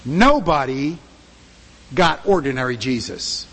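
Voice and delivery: ordinary talk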